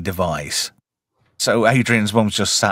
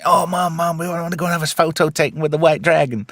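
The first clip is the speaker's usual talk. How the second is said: put-on excited kids; 6 semitones higher, faster than usual. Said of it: "some kids are like".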